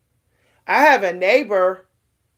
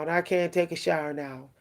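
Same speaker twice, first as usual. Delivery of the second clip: much softer and lower, dying away; fading body voice; 5 semitones lower, 9 dB quieter than usual. Retold or imitated. The exhausted body repeating itself, trailing off much softer. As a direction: let the refusal fade to almost nothing. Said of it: "your body's gonna say".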